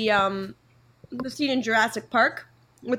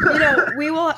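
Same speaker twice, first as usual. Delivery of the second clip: laughing; 3 semitones higher, 5 dB louder than usual. Real, not acted. Laughing at the Disney riff.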